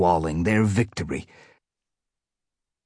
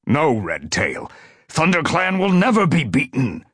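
refuse, then rally with a rising cry; fierce warrior's defiant retort; yowled back; 6 semitones higher than usual. A narrator's reading. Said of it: "Tiger Claw yowled back".

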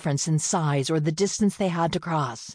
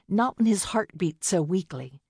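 usual speaking voice

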